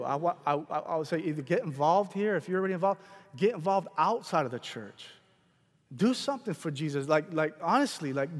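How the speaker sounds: ordinary talk, nothing marked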